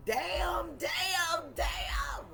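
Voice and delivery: high wailing damn, damn, damn, breaking into a cry; hammed-up lament; mock wail. Real, not acted. Mourning the empty chip bag, a high mock wail.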